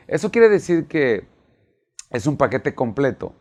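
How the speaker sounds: normal talking voice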